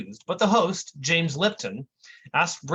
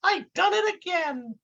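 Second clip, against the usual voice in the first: much higher, careless shrugging tone; dopey kid. Acted. Intern after scalding the agent, high careless voice. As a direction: shrug it off like a dim boy. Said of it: "the kid just shrugs and says".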